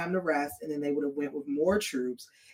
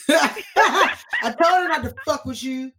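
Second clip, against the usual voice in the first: pitched-up mock tirade breaking into laughter; laughing Drogon insult; 6 semitones higher, 5 dB louder than usual. Drogon taunting Jon, laughing and louder. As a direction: taunt Jon while cracking up.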